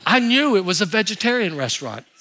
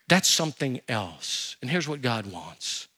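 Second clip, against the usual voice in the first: normal talking voice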